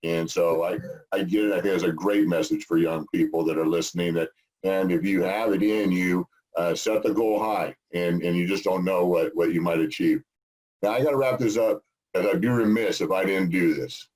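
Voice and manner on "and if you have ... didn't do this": normal talking voice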